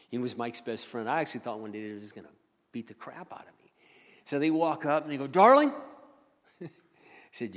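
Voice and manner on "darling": loud, high questioning call; imitated call-out. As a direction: holler it as the rough coworkers. Gang calls out to him, retold.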